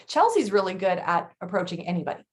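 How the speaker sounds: ordinary talk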